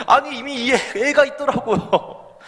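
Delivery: high, slowed words through laughter; cracking up at his own joke; laughing. Real, not acted. Preacher repeating the punchline to congregation, laughing high.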